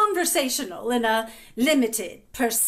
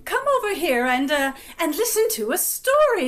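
put-on higher-pitched aunt beckoning children; fussy, high aunt voice; coaxing aunt; 7 semitones higher, 4 dB louder than usual. A narrator's reading. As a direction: call restless children over for a story. Aunt calls the children over.

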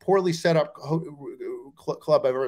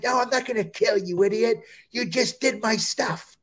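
higher, retold indignant scolding; mock exasperated anger; 6 semitones higher than usual. Headliner accusing comic of doing his stuff, exasperated. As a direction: scold him, stretching the last words. Headliner angry over stolen act.

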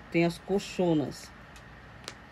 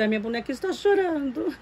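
mock alarm; 7 semitones higher than usual. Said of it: "falo assim".

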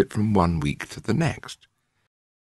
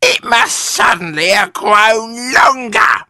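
high, slow, loud screech; alarmed high-pitched wife; Mrs Twit; 8 dB louder, slower than usual. A narrator's reading. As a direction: wail it out in astonishment. Missus Twit alarmed by her stick.

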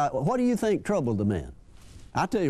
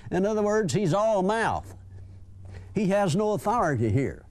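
ordinary talk

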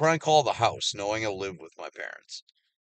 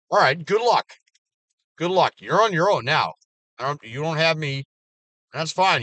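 loud, higher, biting good luck; sarcastic farewell; 6 semitones higher, 6 dB louder than usual. Real, not acted. Writing off fake friends, loud sarcastic sendoff. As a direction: brush them off with mock cheer. Dismissing people who wasted his time.

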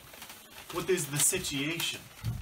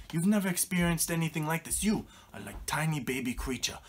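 usual speaking voice

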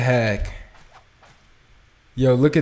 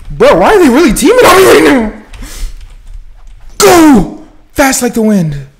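frantic yelling; 12 semitones higher, 10 dB louder than usual. Real, not acted. Teamed on, fleeing.

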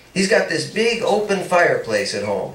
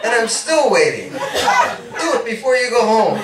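eager insistence; 8 semitones higher than usual. Acted. Paul insists, raised and laughing.

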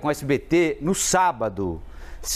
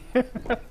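high burst of helpless laughter; hysterical laugh; 11 semitones higher, 3 dB quieter than usual. Real, not acted. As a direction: crack up uncontrollably on air. Live TV blunder, anchor cracks up.

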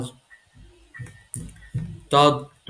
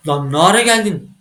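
loud, higher alarmed yell; startled shout; 4 semitones higher, 8 dB louder than usual. Real, not acted. Gamer surprised by Granny, loud startled shout. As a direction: shout as she appears behind you.

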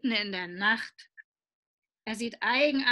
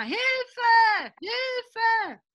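much higher shouted help; calling for help; 12 semitones higher, 3 dB louder than usual. Acted. Trapped boy shouts to his father, high and loud. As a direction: yell for help like a frightened boy. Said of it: "Willi ruft noch immer".

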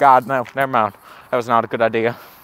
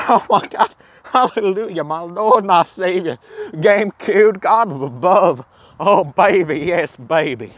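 higher, ecstatic mock hallelujah; giddy mock worship, pitched up; mock praise; 7 semitones higher than usual. Real, not acted. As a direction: praise the sign like a holy relic. Mock worship of GameCube sign.

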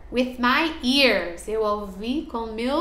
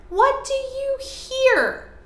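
much higher sing-song question; 13 semitones higher than usual. Acted. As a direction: ask the kids playfully, high. Teacher reads the question to kids.

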